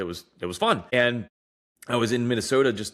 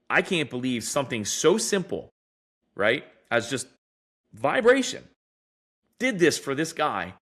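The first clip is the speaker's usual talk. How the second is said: mock disbelief, lifting sharply mid-sentence; retold amazement; 4 semitones higher than usual. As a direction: imitate an amazed doctor, pitch leaping high on "vibration". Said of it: "this doctor was just amazed".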